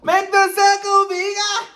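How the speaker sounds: high, loud party call; playful shout. Pretend. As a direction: shout it to the whole room.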